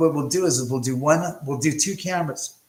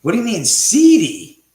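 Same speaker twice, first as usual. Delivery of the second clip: shocked question; 6 semitones higher, 11 dB louder than usual. Real, not acted. Reacting to a chat nickname.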